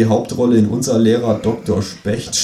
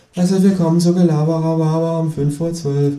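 high, bright host welcome; talk show host; 9 semitones higher than usual. Acted. TV host opening the talk show, presenter voice. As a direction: announce it like a TV presenter.

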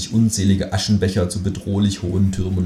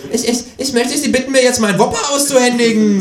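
much higher kangaroo mimicking the stutter; mocking stammer; 4 dB louder than usual. Kangaroo mocking the cashier's stutter. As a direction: parrot his stammer cheekily.

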